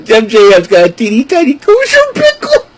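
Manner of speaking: high, laughing voice; fighting back laughter on the punchline; giggling. Real, not acted. Repeats joke, laughing.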